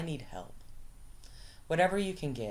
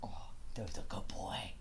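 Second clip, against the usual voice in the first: whispering to a dog; 4 semitones lower, 5 dB quieter than usual. Real, not acted. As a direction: hush it to the pet. Cooing at the dog.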